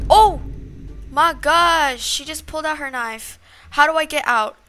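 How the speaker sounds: loud, high startled outburst; panicked. Real, not acted. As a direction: cry out in alarm, desperate to escape. Killer pulls out knife.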